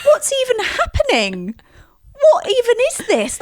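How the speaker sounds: high, incredulous outburst; bewildered disbelief. Retold or imitated. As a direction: pitch leaps up in baffled disbelief. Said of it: "and said, I don't even know what this show is".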